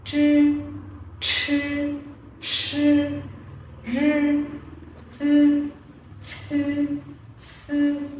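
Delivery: each Chinese initial clearly drawn out with a pause between, "chư, chư, sư, rư, tư, sư, sư"; teacher's sound demo. Shown as a demonstration. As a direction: model "chư", "sư", "rư" as isolated syllables for students to copy. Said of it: "Nhóm thanh mẫu của hôm nay chúng ta sẽ học đó là". (đọc mẫu từng âm "chư, sư, rư" rõ ràng, ngắt quãng)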